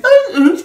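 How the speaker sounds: a squeaky high voice breaking on the euh; cracking voice demo. Shown as a demonstration. Teacher showing a voice breaking, laughing. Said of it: "ça passe de d'en haut à en bas".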